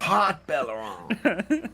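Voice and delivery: "Hot" with a hard H and T, said slowly; "Hot Belleron", drawn out and anglicized; anglicized pronunciation. Shown as a demonstration. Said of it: "I'm still calling it Hot Belleron".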